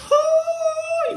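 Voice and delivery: excited high-pitched oh; high thrilled oh. Real, not acted. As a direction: voice leaping very high with delight. Tasting the snack.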